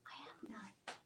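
barely audible, hushed reply; meek whisper. Retold or imitated. The accused woman answering Jesus, meek whisper.

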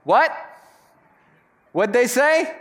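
mock straining to hear. Pastor imitating a diner stuck at the corner seat, straining to hear. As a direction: play the guest who can't catch a word. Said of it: "you spend the whole meal going".

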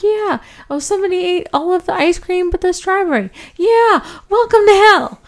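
mock demon taunt. Demon gloating that only strawberry is left, high mock taunt. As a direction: tease like a smug cartoon demon. Demon mocking soul about ice cream.

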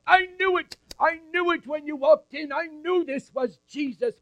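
high, loud, ecstatic proclaiming; Anna overjoyed. Acted. Anna proclaims the baby as Messiah, high and ecstatic. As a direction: jubilant old woman shouting praise to everyone. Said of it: "jump so high or move so fast".

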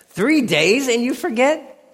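high, slow disbelieving cry; incredulous. Real, not acted. Preacher incredulous at forgetful cupbearer.

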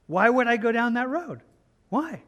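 acted disbelief, pitch jumping up; incredulous questioning. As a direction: ask it as if the idea makes no sense. Acting a baffled refusal to tempter.

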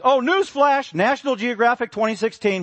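loud, high triumphant announcement; newsflash. Real, not acted. Preacher springing a surprise fact on listeners, excited. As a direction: trumpet the news like a headline.

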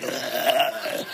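soft disgusted gag; a quiet eye-rolling ugh; gag sound. Retold or imitated. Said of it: "I think I made, like, the gag sign".

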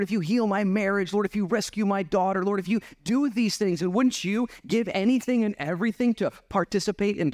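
high, pleading run of promises; lightly acted desperate bargain with the Lord; bargaining prayer. Acts out bargaining with God.

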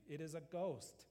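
fearful cry. A narrator's reading. Disciples see him walking on the sea, mild fearful cry. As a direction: cry it out with a touch of fear. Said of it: "they cried out for fear".

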